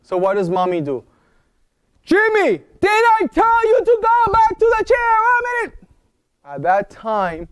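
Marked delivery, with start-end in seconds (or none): 2.0-5.8 high, loud exasperated mother; scolding mom. Retold or imitated. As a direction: scold the kid for the hundredth time. Mommy scolding Jimmy.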